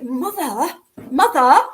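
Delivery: retold embarrassed telling-off; imitating exasperated son. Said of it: "he's like".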